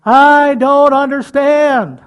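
loud, high, slow cry to God; shout. Retold or imitated. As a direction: cry out in anguish. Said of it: "there's other times I gotta shout".

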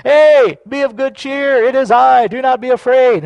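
high, loud call across water. Acted. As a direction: hail them with hearty reassurance. Acting Jesus calling across the water.

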